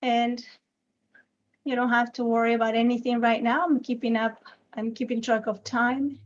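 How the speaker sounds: unhurried meditation guide with long silences between phrases; guided meditation. Acted. Teacher guiding a group's attention to the body, calm guided meditation. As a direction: gently lead them into noticing the body, leaving lots of space.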